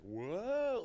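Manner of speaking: high, drawn-out mock astonishment; playful whoa. Dad plays blown away for his little one, high mock whoa. Dad's playful reaction to child blowing.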